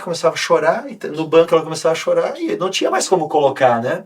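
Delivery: usual speaking voice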